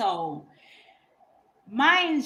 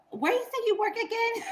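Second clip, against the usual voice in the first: high, probing mock-innocent ask; high-pitched loaded question; pointed question. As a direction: needle him with a sweet high question. Pointed question to evasive guy.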